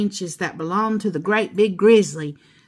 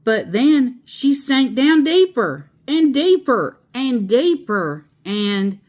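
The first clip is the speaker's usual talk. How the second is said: usual speaking voice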